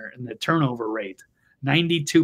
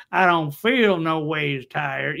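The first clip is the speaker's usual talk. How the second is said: slow, laughing mock Southern preacher accent; slow and laughing, sending up a fake Southern accent; slower than usual. Host mocking Hillary's fake accent with a slow laughing Southern accent. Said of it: "put on that fake".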